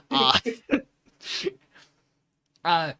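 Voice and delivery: normal talking voice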